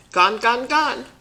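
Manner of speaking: put-on little toddler voice. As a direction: chirp it like a small grandson. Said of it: "Remember he used to say that".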